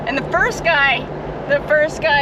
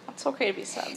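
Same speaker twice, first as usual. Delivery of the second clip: very soft, reassuring parent voice; gentle comforting; 14 dB quieter, much faster than usual. Real, not acted. Parent consoles a sad child.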